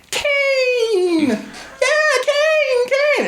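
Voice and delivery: put-on very high squealing children's voices; excited kids shouting. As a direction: shriek the name like thrilled kids. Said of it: "They go".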